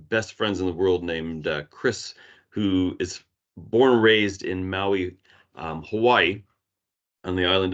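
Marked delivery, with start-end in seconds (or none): none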